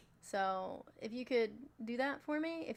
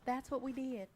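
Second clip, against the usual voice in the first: put-on southern accent. Host hamming a southern accent for chat. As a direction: lay on a thick southern belle voice. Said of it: "start speaking in my southern accent".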